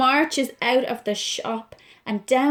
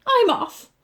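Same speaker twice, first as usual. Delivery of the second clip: high, sharp storming off; a mild huff as he leaves; huffy exit; 11 semitones higher, 4 dB louder than usual. Acted. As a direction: Pete walking out in a mock huff. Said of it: "said Pete".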